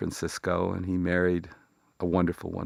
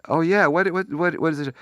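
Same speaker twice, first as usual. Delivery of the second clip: high, urgent repeated what; worried, pressing for the news; anxious; 11 semitones higher, 7 dB louder than usual. Retold or imitated. Friend hearing bad news, high anxious voice.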